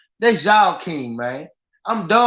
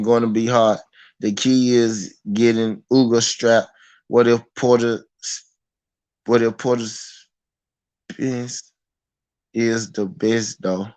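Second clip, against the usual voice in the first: quoting someone; 7 semitones lower than usual. Retold or imitated. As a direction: draw it out low like a pondering fan. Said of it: "He said".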